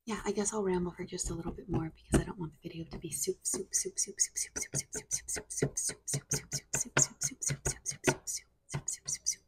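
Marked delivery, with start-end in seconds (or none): 3.4-9.4 rapid repeated whispered words; quick breathy ASMR trigger words; fast whispering asmr. Acted.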